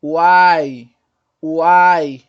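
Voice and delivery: UAI drawn out loud as one gliding syllable; pronúncia demonstrada. Shown as a demonstration. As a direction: say "Uai" long and loud, all three sounds in one glide. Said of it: "Vamos observar aqui o guai". ("Uai" alto e alongado, numa sílaba só)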